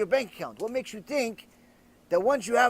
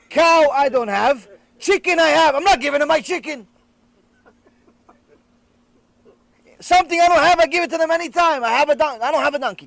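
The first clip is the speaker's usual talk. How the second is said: higher and louder, building into an indignant rant; rising outburst; 5 semitones higher, 8 dB louder than usual. Retold or imitated. Man shouts his reasoning at his friend, loud and high. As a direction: insist loudly, almost shouting the logic. Man explains chicken logic loudly.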